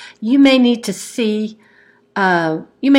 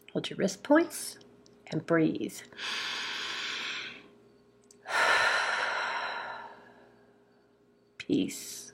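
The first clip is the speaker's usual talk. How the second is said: guided tapping script; 4 semitones lower, 5 dB quieter than usual. Acted. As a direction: lull the listener into calm, softly. Leads tapping affirmations, soft.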